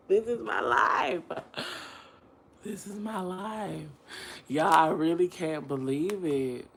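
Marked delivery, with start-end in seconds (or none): 2.6-3.9 resigned murmur. Real, not acted. Lamenting her life.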